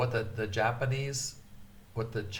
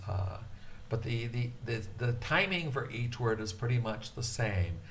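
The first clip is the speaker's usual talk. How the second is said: ordinary talk, nothing marked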